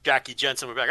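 ordinary delivery

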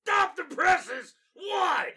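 shouting editor. Acted. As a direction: bellow the questions. Newsroom shouting.

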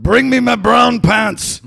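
very high, loud, slow shout; punchline shout. Retold or imitated. Captain screaming his order at the crew, loud slow high shout. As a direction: bellow it out in sheer panic. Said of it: "And the captain yells out".

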